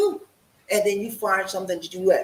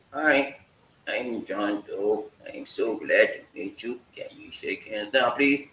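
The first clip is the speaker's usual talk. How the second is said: lower, slow, mechanical hello; stiff robot-like greeting; 9 semitones lower, slower than usual. Pretend. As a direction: read it stiff and flat like a robot. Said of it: "You look like a robot".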